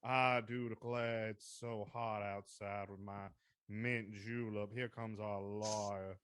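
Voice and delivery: slow, soft drawling Southern belle; quiet, slow, hammed-up Deep South drawl. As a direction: laze through it like a plantation lady with a mint julep. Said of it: "they talk like this".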